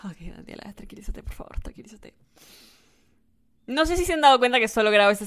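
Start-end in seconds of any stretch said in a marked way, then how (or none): 0.0-2.1 hushed self-calming whisper; soft whispered self-talk; whisper to herself. Real, not acted. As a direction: whisper yourself back to calm. Calming herself down after excitement.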